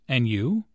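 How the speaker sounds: "And you?" with a rise on YOU; "you" swinging upward, handing the question back; rising intonation. Shown as a demonstration. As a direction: lift the pitch at the end of "And you?". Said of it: "Notice the rising intonation on".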